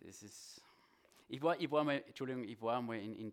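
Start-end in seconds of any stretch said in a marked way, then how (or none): 0.1-0.6 whispered aside. Real, not acted. Preacher stumbling, whispered aside. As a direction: breathe it under your breath.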